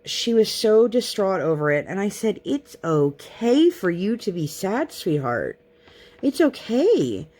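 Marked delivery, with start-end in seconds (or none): 2.5-7.3 slow, higher and gentle reassurance; soothing mommy voice. Retold or imitated. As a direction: mom calming her tearful daughter, slow and tender. Mother soothing sad toddler.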